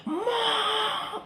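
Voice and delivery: high, drawn-out mock scream for mama; teriakan memanggil mama. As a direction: imitate straining to scream for mother, pitch way up without much volume. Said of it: "panggil mama saya". (menirukan teriak panggil mama, melengking tertahan)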